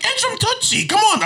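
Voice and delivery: high mocking voice of a know-it-all partner; mock high girlfriend voice. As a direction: mimic her insisting in a high squeal. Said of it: "she's like".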